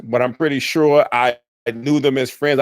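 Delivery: ordinary delivery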